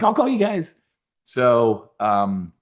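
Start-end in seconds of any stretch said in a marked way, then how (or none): 0.0-0.7 excited quote. Retold or imitated. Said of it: "She's like".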